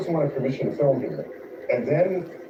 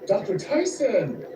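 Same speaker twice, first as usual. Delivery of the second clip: Superman voice; 7 semitones higher, slower than usual. Acted. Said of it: "That was Superman".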